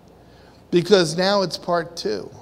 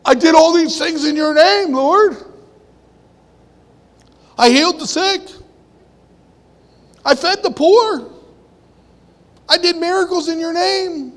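loud, high desperate plea; high pleading cry to God; 9 semitones higher, 6 dB louder than usual. Retold or imitated. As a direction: beg the Lord loudly. Retold plea of the man to God.

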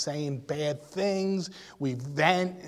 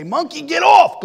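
loud, high yell telling it to get off; shouting at the monkey; 10 semitones higher, 12 dB louder than usual. Retold or imitated. Preacher retelling how he shouted at his burden. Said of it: "I used to walk the jungle and say".

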